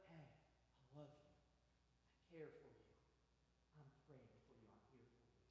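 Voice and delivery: soft and low, each line gentler; tender comfort. Retold or imitated. Pastor showing how to comfort a grieving friend, tender and soft. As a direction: hold them close with your voice.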